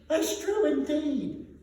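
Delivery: put-on high, slow agreeing old woman; laundress. A narrator's reading. Laundress agreeing with the charwoman, high slow voice. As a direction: drawl it in eager agreement. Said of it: "said the laundress".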